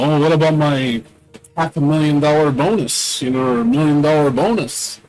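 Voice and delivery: mock whiny executive. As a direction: whine about the bonus like a spoiled boss.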